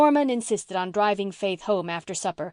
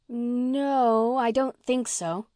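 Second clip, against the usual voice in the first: put-on higher girlish voice, drawn-out No; doubtful girl; 5 semitones higher, much slower than usual. A narrator's reading. Girl asked about father's sermons, hesitant.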